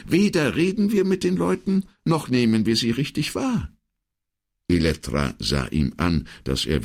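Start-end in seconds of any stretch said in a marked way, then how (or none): 0.1-3.7 high-pitched secretary arguing her point; insistent secretary. Acted. Secretary contradicts the commissario, insistent and high. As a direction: press the point in a higher voice.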